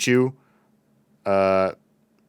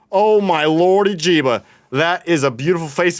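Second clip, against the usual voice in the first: gushing delight; 6 semitones higher, 4 dB louder than usual. Real, not acted. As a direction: melt over a cute face. Gushing over a skin's face.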